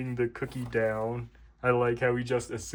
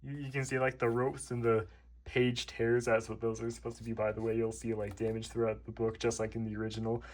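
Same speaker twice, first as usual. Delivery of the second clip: ordinary delivery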